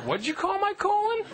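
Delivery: high, offended "colon?" question; confused friend. Retold or imitated. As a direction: squeak out the misunderstanding. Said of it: "They're like".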